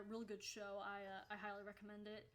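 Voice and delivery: normal talking voice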